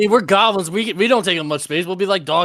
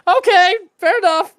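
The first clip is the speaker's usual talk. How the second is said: very high, loud hasty surrender; hammed-up nervous acceptance; mock intimidated diner; 10 dB louder than usual. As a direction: back down in a squeaky pretend panic. Acted diner backing down from cook.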